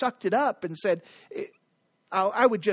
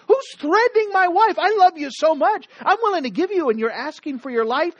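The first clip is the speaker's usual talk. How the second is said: higher, louder furious demand; angry king; 8 semitones higher, 5 dB louder than usual. Acted. King furious at whoever threatens his wife, shouting. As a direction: roar at the unseen threat. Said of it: "He gets mad".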